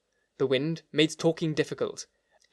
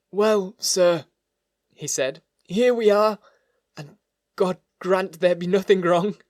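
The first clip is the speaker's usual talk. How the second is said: put-on anxious old butler; 5 semitones higher, 6 dB louder, slower than usual. A narrator's reading. Butler Poole greets the lawyer.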